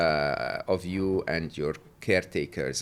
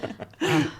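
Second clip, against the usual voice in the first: high, bright burst of laughter; real laugh; 5 dB louder than usual. Real, not acted. Guest laughs at the host's joke, real burst of laughter.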